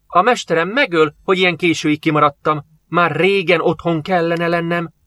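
put-on high, wailing slave boy, slowing at the end; high frightened wail. A narrator's reading. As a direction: cry out in fear of the master. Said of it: "jajdult fel Utet".

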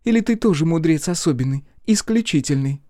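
high, teasing question; amused surprise. A narrator's reading. Girl teases the orator as special, amused surprise. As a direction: needle gently in a high voice. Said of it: "с удивлённой улыбкой спросила".

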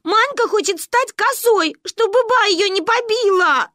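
very high, loud wail; bawling. A narrator's reading. Child running to tell mother, bawling. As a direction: burst into a childish bawl. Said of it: "разревелась я".

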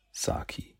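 breathy whispered name. A narrator's reading. Nagi whispers his little brother's name. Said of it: "ist seine Stimme ruhig".

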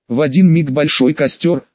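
ordinary delivery

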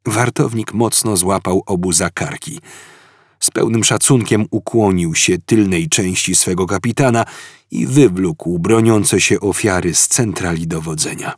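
usual speaking voice

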